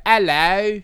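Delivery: high, loud put-on British greeting; bad British accent. Shown as a demonstration. Host trying out accents on co-host, bad British accent. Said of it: "I'm a British person".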